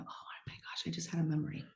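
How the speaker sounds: whispered little gasp; hushed realization. Retold or imitated. Sitter suddenly remembering her dad, whispering. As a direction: whisper it as the memory hits. Imagined moment of recalling memory.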